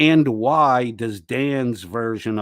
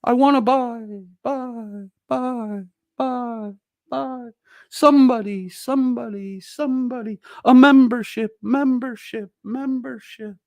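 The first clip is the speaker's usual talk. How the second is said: mocking Gia; 13 semitones higher than usual. Mocking Gia calling out alone in an empty paywalled room, high and plaintive. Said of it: "Gia might be in there going".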